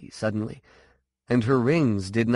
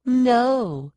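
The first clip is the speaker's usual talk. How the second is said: high, forceful no; raised, mocking refusal; defiant echo; 14 semitones higher than usual. A narrator's reading. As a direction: throw the word back, pitched way up. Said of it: "Sean echoed".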